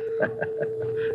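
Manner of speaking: shrill little mocking laugh; a brief, soft, high cackle; high giggle. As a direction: giggle at his attorney. Host laughs at humiliation.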